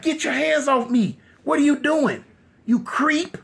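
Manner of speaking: high-pitched, outraged woman, retold; indignant protest. Groped wife protests angrily.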